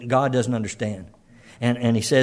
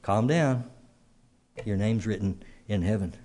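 softer, gentle reassurance; calm, soothing Lord; 4 semitones lower, 8 dB quieter than usual. Pretend. Preacher voicing the Lord calming a troubled listener, softly reassuring. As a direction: soothe the anxious soul quietly. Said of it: "the Lord's just saying".

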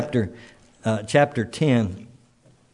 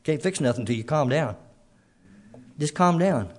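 a soft, soothing hush; gentle calm down; 2 semitones higher, 5 dB quieter than usual. Pretend. Preacher voicing the Lord telling the stressed to calm, softly. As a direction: quietly settle them down. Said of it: "he says".

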